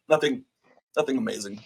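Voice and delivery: normal talking voice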